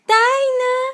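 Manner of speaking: high excited call of the name. Real, not acted. Parent exclaiming delightedly during signing practice.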